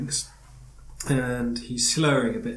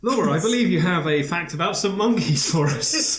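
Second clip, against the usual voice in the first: laughing; 5 semitones higher, 6 dB louder than usual. Real, not acted. Laughing at the joke.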